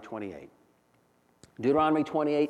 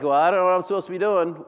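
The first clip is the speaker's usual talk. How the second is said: fast, louder, higher whiny shrug; mock aimless believer; 5 semitones higher, 7 dB louder, faster than usual. Preacher voicing an aimless believer, fast and louder. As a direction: toss it off like a lost, lazy believer. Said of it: "So if you're like".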